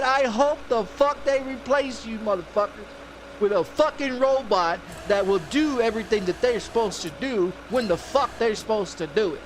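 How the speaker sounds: loud, forceful chewing-out; heated replay of a phone tirade; retold angry rant. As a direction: hammer each phrase at the clerk. Retelling his phone tirade.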